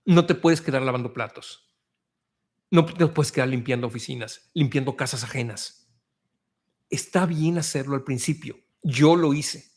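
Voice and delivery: ordinary talk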